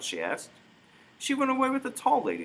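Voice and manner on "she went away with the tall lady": put-on high, innocent small girl's voice; a tiny sister telling her mother plainly; little girl. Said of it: "the little sister said".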